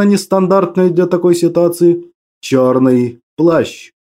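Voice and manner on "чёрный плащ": very slow, low and ominous; dark lord. A narrator's reading. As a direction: confirm it like a menacing villain. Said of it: "подтвердил я".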